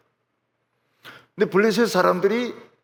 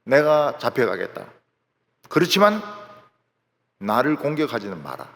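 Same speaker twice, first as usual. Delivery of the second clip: Samson proposing; 6 semitones lower than usual. Acted. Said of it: "삼손이 제안을 합니다".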